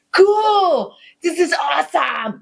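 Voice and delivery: put-on a kid gleefully cheering at the gore; excited kid. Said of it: "in the back, we hear".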